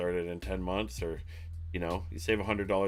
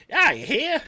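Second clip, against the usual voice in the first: loud, high mock New Yorker; hammed-up New York tough talk; bad New York accent; 11 dB louder than usual. Joking about an accent for the boss, exaggerated New York accent. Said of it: "like, New York, or I don't even know what accent".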